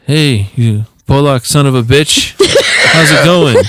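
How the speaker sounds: high, loud imitation of a swaggering hello; cocky greeting. Kid greeting the barber with insults, imitated cocky greeting. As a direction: act out a kid trying out man-talk.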